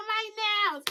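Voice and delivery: high, squealing mock wail of a fussy girl, broken by laughter; mock whiny girl. Mocking Jezebel slipping in the river, a high whiny wail. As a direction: squeal it like a spoilt girl losing a nail. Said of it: "she's like".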